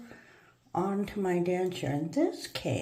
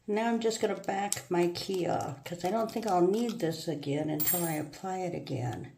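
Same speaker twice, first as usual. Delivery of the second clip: usual speaking voice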